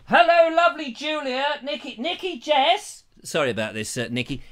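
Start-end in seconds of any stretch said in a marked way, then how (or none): 0.1-1.6 high sing-out to someone not listening; calling to her. Pretend. Relaying the message to sleeping Julia. 1.6-3.0 high, slow, drawn-out name call; calling to her. Pretend. Still calling message out to Julia.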